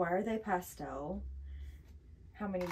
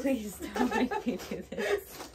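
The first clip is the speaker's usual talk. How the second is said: laughing plea; 3 dB louder than usual. Real, not acted. Begging before spicy chip.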